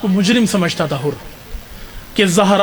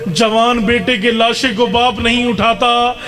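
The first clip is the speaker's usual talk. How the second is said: rising grief-laden lament, falling away at the end; impassioned majlis lament; 7 semitones higher than usual. Acted. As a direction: pour it out as a wailing climax, pitch rising. Climax of the lament recital.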